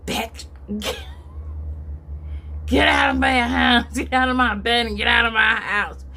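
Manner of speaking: angry shout to get out; retold yelling. Billy yelling at her to leave his house. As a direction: bark the order to leave. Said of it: "and they're yelling".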